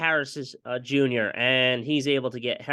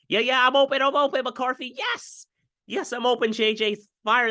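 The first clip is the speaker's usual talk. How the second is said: 1 squeaky open receiver; 11 semitones higher than usual. Pretend.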